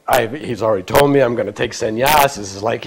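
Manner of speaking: usual speaking voice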